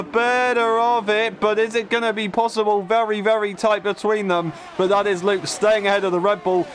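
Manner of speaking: excited play-by-play, voice lifted; motorsport commentator. Real, not acted. Live race play-by-play.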